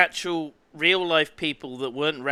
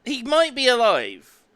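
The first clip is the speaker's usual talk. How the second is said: excited; 10 semitones higher, 7 dB louder than usual. Pretend. Spooky ragdoll reading.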